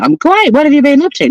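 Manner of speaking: high playful Aussie chat; high-pitched Aussie banter; mock Aussie viewer. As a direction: put on a light Aussie voice. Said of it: "you're all like".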